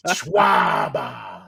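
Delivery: low, drawn-out Boston shout; hammed-up Boston "Schwaba"; Boston accent. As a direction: bellow it low in broad Boston. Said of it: "I love Boston accents".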